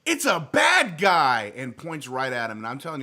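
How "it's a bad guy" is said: high, loud kid yell calling out the bad guy; a little girl pointing and shouting to her dad; shrieking at the top of her voice to daddy; child's loud shout. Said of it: "loud as she can".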